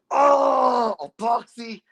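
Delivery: high, loud anguished cry at a friend; real cry of being trolled; frustrated yell. Real, not acted. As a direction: wail the name in real exasperation. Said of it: "I'm so mad at you right now".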